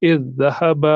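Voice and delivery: "इज़ ज़हब" joined with a doubled consonant; careful "इज़ ज़हब", first consonant fused into the second; merged pronunciation. Shown as a demonstration. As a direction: recite "इज़ ज़हब" as "idh-dhahaba". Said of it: "हम पढ़ेंगे".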